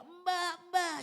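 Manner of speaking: high, loud mock toddler call; imitating crying child. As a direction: imitate a little one crying out for the nanny. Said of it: "Dia nangis".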